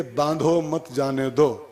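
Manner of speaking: slow, pause after "बांधो", then "मत जाने दो" together; measured "बांधो, मत जाने दो"; written order. Shown as a demonstration. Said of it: "लिख के दे दिए".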